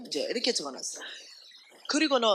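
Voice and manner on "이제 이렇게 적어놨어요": ordinary talk, nothing marked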